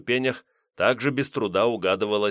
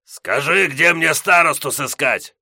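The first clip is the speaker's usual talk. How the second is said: battle roar; 6 semitones higher, 7 dB louder than usual. Acted. Shouting through door.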